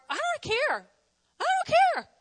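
high, careless mock shrug; a mock don't-care tone; mock indifference. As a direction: play the uncaring person, lightly.

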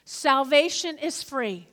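slow, deliberate note-taking pace; slow dictation. Shown as a demonstration. Pastor dictating to the congregation, slow and deliberate. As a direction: spell it out slowly for notes. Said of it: "write down".

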